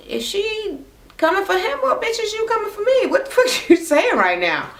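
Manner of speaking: high-pitched incredulous challenge; indignant retort. Retold or imitated. Malika challenges him over coming for her, indignant and high.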